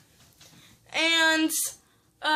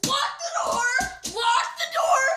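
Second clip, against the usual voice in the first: panicked shout; 10 semitones higher than usual. Retold or imitated. Hiding in the garage from the truck, panicked shout. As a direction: yell it while slamming into the garage. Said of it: "we're like".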